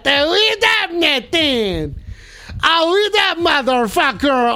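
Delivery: high, slow shout of surprise; excited yell. Real, not acted. Streamer reacting to the game live, with a high excited yell. Sudden game event, yelling.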